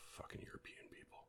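very soft, whispery aside; muttered grumble. Real, not acted. Grumbling about Europeans mid word list, muttered low. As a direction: grumble it under the breath. Muttered gripe after saying aluminium.

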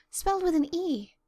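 a slightly breathless, eager correction; gasped. Acted. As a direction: blurt it out eagerly, a touch of a gasp. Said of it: "gasped Anne".

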